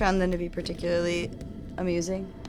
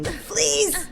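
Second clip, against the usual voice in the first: indignant shout; 12 semitones higher, 4 dB louder than usual. Real, not acted. Outburst at the game.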